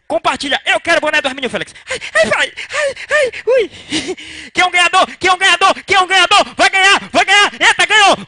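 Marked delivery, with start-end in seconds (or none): 1.9-3.3 high squealed ai ai ai; squealing ai. Acted. Mock squealing. 4.5-8.3 loud, high, hyped repeated question; building up to a giveaway winner; hyped announcer. Real, not acted. Hyping live giveaway.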